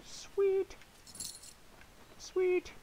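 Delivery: very high squeaky cheer; squeaky sweet. Acted. Gamer squeaking at loot, high squeak. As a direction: squeal it like a cartoon.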